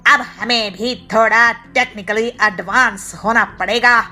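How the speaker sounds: rally the gang; loud crook voice; second thief. A narrator's reading.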